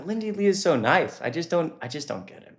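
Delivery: normal talking voice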